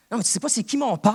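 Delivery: fast, loud, high rebuke, daring the devil; defiant rebuke. Shown as a demonstration. Preacher rebuking the devil to his congregation, defiant loud challenge. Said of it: "je parle comme ça avec le diable des fois".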